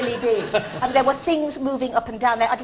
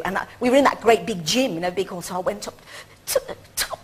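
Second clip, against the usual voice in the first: ordinary talk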